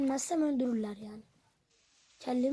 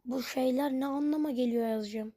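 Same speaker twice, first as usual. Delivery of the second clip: usual speaking voice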